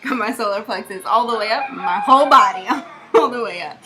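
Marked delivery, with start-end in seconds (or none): 1.7-3.8 laughing. Real, not acted. Laughing about being sore.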